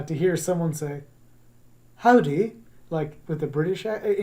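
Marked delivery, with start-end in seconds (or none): 2.0-2.6 loud, higher mock-English greeting; English accent. Shown as a demonstration. Teacher showing a mismatched greeting, English accent. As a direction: attempt at a British accent, pitched up. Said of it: "English accent".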